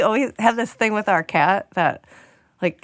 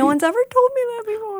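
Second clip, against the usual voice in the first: mock crying; 14 semitones higher, faster than usual. Touched by a compliment.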